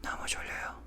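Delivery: breathy whispered sleepy line; soft ASMR-style whisper. Shown as a demonstration. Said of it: "almost like A S M R".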